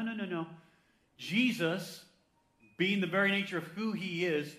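ordinary delivery

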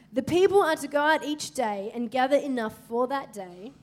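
loud preached Bible reading; forceful scripture reading. Acted. Preacher reading Exodus aloud to the church with full force. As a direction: proclaim the verses.

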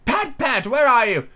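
high-pitched cross calling; irritated master summoning a servant, pitched high; angry Rabbit. A narrator's reading. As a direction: call out angrily as a high-voiced Rabbit. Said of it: "Next came an angry voice".

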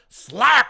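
loud spoken slap; slap sound effect. Retold or imitated. Narrating the next slap with a loud sound word. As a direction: punch out the slap noise.

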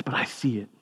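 a hushed, tender divine reassurance; quiet voice of God. Retold or imitated. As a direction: say it gently as God seeing worth.

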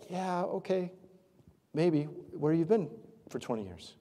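softly doubtful, hedging agreement; quiet, noncommittal mock reply; skeptical reply. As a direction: go along half-heartedly, unconvinced. Acted doubtful reply to God's command.

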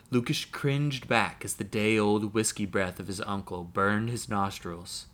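plain reading voice

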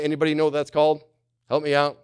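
ordinary talk

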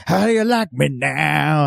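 slow, drawn-out taunt. Retold or imitated. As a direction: sneer it like a cowboy showing off. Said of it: "this guy just".

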